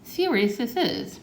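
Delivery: slow, high moaning plea; moaned. Acted. Grandpa pleading over lost teeth, slow mock moan. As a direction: drag it out like a sorry old man. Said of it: "moaned Grandpa".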